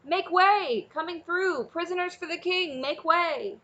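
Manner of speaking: high, loud herding shout. Acted. Escort dragon shouts to part the crowd of dragons, loud. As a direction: bellow to clear the path. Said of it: "he raised his voice to a shout".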